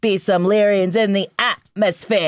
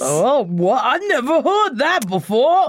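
sarcastic mock surprise; 7 semitones higher than usual.

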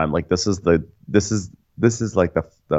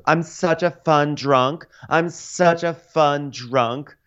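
higher, very slow, drawn-out sing-song; fun drunk declaration; 9 semitones higher, much slower than usual. Retold or imitated. Tipsy woman insisting she is fun, higher and slow. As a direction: sell how fun you are, slowly. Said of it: "declarations of".

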